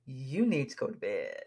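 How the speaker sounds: low, calm husband telling her to bed; lightly voiced husband. Husband telling tired wife to bed, low.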